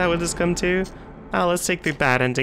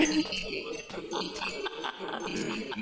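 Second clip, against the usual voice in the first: breathy scared laugh; 9 semitones higher, 13 dB quieter than usual. Real, not acted.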